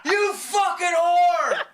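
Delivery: yelling at the heckler, strained scream. Retold or imitated. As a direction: scream it till the voice cracks. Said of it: "Like that".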